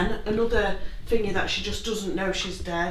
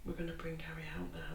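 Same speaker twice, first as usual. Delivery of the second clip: whispering; 4 semitones lower, 19 dB quieter than usual. Real, not acted. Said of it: "I've been really whispering".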